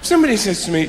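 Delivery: ordinary talk, nothing marked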